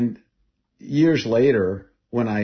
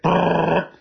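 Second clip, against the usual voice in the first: imitated machine-gun burst, rolled; gun rattle; 8 semitones higher, 4 dB louder than usual. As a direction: rattle it out like an automatic rifle. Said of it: "and she's like".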